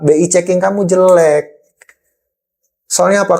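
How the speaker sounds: ordinary talk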